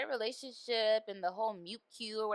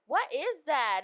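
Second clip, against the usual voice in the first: mock nosy admirer; 9 semitones higher, 5 dB louder, slower than usual. Imitating nosy people.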